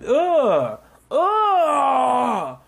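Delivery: high exasperated ugh; annoyed groans. Real, not acted. Fed up with Hazel's comments, high exasperated groans.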